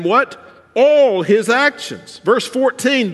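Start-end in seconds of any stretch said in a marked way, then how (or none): 0.7-2.2 "All" held long, loud and high, slow; stress on all. Acted. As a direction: hammer the word "All". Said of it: "I just wanna emphasize a word".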